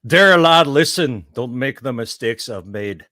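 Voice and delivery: normal talking voice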